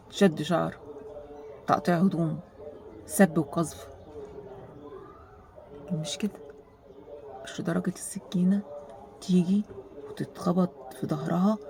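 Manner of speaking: soft whispered disbelief. Real, not acted. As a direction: drop to a whisper. Unvoiced whispered aside. (همس خافت)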